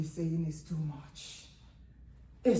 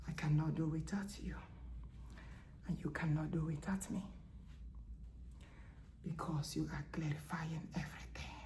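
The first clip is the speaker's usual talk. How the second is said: soft voice of God; 9 dB quieter than usual. Acted. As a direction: let God confide in his queen, softly. Voicing God softly.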